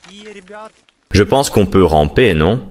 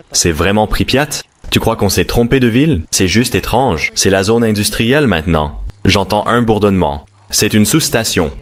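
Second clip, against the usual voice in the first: usual speaking voice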